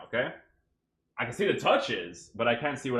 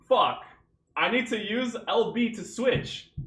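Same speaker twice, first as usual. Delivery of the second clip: frustrated outburst; 10 semitones higher, 5 dB louder than usual. Retold or imitated. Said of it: "I'm like".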